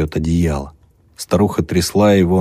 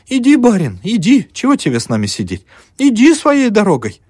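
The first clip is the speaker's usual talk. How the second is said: put-on high, loud, bustling old peasant woman; fussing old woman; 13 semitones higher, 4 dB louder than usual. Old mother bustling over the student's bed. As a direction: flutter about the guest, all eager to please.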